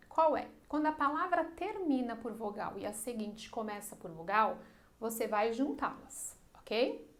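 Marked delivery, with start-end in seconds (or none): none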